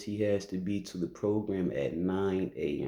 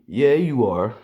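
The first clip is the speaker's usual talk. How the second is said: loud, high retold comeback; teasing retort; 4 semitones higher, 8 dB louder than usual. Aunt answering nephew's no-cussing promise, loud teasing retort. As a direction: cut in loudly, pitch raised.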